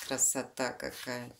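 plain reading voice

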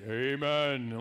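deep low murmured amen. Retold or imitated. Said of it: "like, in a low voice".

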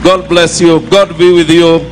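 ordinary delivery

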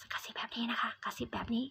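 fast, hushed whisper. Shown as a demonstration. Teacher shows students what whisper means, whispered. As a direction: drop to a voiceless whisper.

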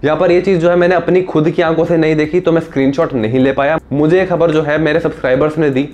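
ordinary talk

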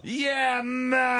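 very high, held-out note; high drawn-out agreement. Acted. Co-host chiming in on the go-along attitude, high and drawn out. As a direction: stretch it out high, almost sung.

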